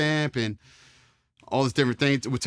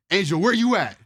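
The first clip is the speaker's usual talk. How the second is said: higher, louder bark of a name; calling out; 7 semitones higher, 4 dB louder than usual. Retold or imitated. Drill instructor calling a recruit out of line, louder and higher.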